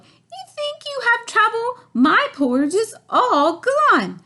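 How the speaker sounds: very high, loud little bear wail; Baby Bear wailing. A narrator's reading. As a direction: wail like a small cub. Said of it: "Baby Bear wailed".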